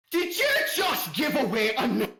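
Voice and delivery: high, loud outraged shout; scandalized, high-pitched holler; outraged yell. Acted. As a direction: yell it in like an angry wife. Unseen woman yelling at someone.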